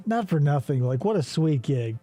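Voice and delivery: ordinary delivery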